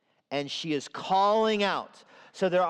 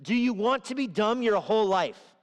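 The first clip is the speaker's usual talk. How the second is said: wisdom calling out; 5 semitones higher than usual. Acted. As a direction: call out to the sinner like a stern teacher. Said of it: "the voice of wisdom says".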